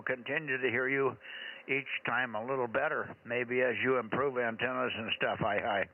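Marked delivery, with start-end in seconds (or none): none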